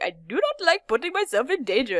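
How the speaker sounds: timid bat with a put-on Indian accent; badly faked Indian accent; mock Indian accent. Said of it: "with an accent".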